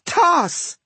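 sudden high-pitched yell; sharp, high shouted order; yelled command. A narrator's reading. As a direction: yell the single word like a spell. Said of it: "she yelled".

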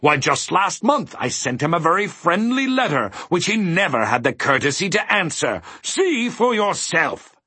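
high-pitched, aggrieved tirade; indignant fury. A narrator's reading. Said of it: "his sadness had changed to fury".

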